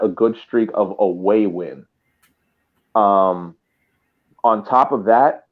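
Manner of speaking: ordinary talk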